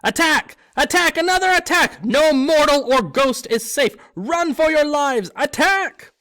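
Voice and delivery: high shrieking alarm, very loud; gleeful poltergeist shrieking for everyone to run; screaming alarm. A narrator's reading. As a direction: scream it to the whole corridor.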